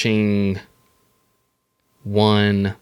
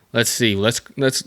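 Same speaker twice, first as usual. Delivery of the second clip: ordinary delivery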